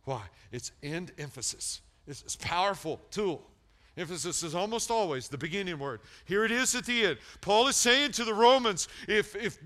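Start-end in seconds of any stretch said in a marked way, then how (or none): 2.1-9.6 fired-up sermon peak; full-force preaching. Real, not acted. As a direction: hammer it home loudly.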